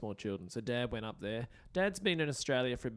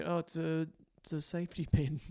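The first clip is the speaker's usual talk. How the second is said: put-on London accent; 4 semitones higher than usual. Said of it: "I feel like I can do an accent".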